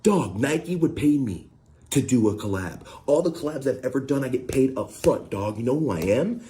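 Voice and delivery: high-pitched, indignant imitation; imitated high-voiced rant; mock going off. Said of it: "he's just going off like".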